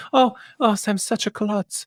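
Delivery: high, airy fake innocence; fake clumsy. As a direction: play it like a pretend accident.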